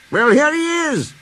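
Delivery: lightly voiced jolly boss; cheerful Fat Controller. A narrator's reading. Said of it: "said a cheerful voice".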